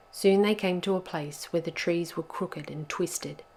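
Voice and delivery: ordinary delivery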